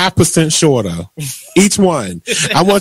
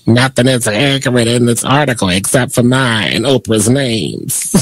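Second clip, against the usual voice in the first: lightly voiced Madea, pitched lower; 5 semitones lower than usual. Host finishing Tyler Perry's statement for laughs, low mock Madea voice. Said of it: "That was my Madea voice".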